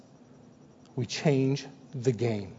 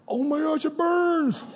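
squeaky high strained cry; hammed-up pain at the burn; straining at the gym, high mock agony; 13 semitones higher than usual. Straining at the workout.

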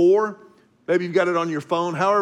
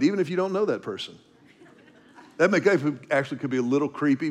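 usual speaking voice

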